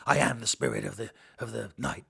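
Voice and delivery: low, dramatic Batman line. Retold or imitated. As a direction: intone it like Batman. Mocking wordy script line.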